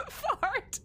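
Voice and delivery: high squeal through laughter; helpless giggling. Real, not acted. Player giggling at own rude word, laughing hard. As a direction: blurt it out while cracking up.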